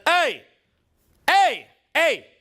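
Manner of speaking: high, short projected heys; calling over noise. Shown as a demonstration. Coach getting attention in loud clubs, calling out. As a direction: hail people across a loud bar. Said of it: "learned all this in really loud bars".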